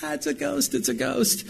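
tiny high-pitched shriek of fright; comic falsetto scream, held back in volume; mock scream. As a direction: imitate a grown man squealing in terror. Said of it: "he's just screaming".

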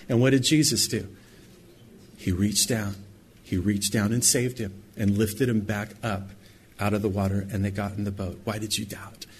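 ordinary talk

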